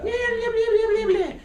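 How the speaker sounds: very high mock jabber; nagging inner voice. Preacher mimics the hateful voice saying what it wants, squeaky mock nagging. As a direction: squeal it as silly, meaningless chatter. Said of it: "let that voice say what it wants".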